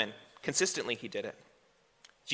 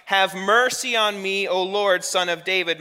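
crying out; 5 semitones higher, 6 dB louder, slower than usual. A narrator's reading. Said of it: "came out and was crying".

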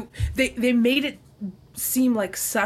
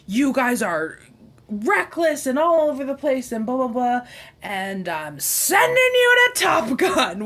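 higher, louder bro voice; frat bro; 6 semitones higher, 6 dB louder than usual. Retold or imitated. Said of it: "this is when he's like".

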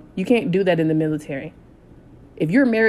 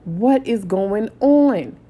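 slow, louder bewildered demand; angry disbelief; 3 semitones higher, 4 dB louder, much slower than usual. Retold or imitated. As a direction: drag out the outrage. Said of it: "I was so angry".

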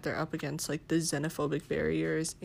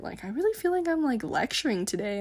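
normal talking voice

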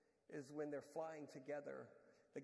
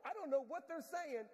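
ordinary talk

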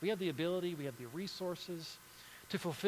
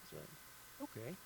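ordinary delivery

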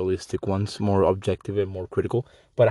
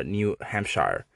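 ordinary talk, nothing marked

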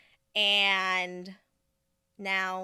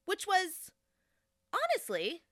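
usual speaking voice

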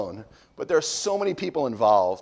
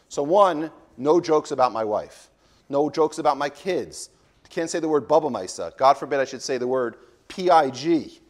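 ordinary talk

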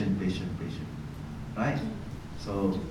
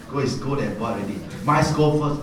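ordinary delivery

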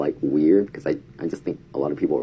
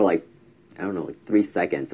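ordinary delivery

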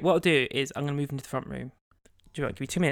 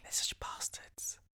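slow, very soft whisper; real whispered grumble; 15 dB quieter, slower than usual. Real, not acted. Host whispers about banging neighbour to co-host, hushed grumble. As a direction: curse them under your breath. Neighbour just banged on the ceiling.

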